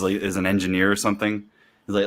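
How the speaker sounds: ordinary talk, nothing marked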